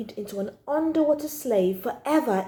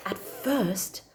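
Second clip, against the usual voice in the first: hushed whisper, no voice; 4 semitones lower, 5 dB quieter than usual. A narrator's reading. Child narrator whispering to the listener. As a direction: lean in and whisper it.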